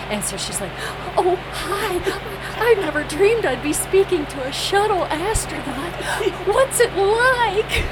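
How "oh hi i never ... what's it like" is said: high, breathless squeal of delight; giddy and excited. Retold or imitated. New flight attendant gushing to the shuttle astronauts, giddy squeal. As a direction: gush like a starstruck new hire. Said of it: "the girl's just sitting there all excited".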